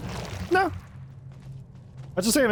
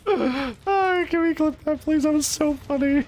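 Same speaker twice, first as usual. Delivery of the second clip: laughing; slower than usual. Real, not acted. Laughing after funny play.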